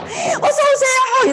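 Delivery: high, slow sing-song welcome; gushing welcome. Retold or imitated. 자기 파트너에게만 높고 느리게 하는 환영. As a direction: lay the sweetness on thick. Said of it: "내 파트너만 오면 막".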